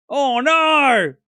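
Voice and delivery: high, loud, drawn-out wail; mock dismay. Bemoaning the three-hour wait, mock dismay. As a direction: wail "oh no" for comic effect.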